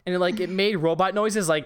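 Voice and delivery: ordinary talk, nothing marked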